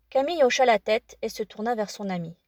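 plain reading voice

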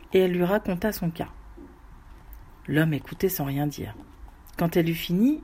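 ordinary delivery, nothing marked

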